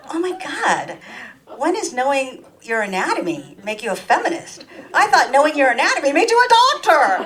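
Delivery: high, loud incredulous outburst; mock outrage, voice jumping up; mock disbelief. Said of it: "And I'm like".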